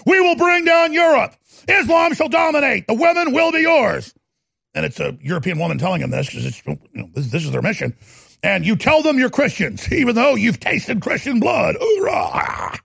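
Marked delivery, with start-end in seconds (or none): 0.1-4.1 much higher, louder fanatical shouting; shouted jihadist imitation. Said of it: "it's like". 8.4-12.8 higher mock war cry; rally the crowd with a cry; shouted fanatic imitation.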